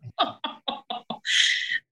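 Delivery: airy, high giggle; real laugh. Real, not acted. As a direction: let out a long breathy laugh. Narrator laughs at her own story.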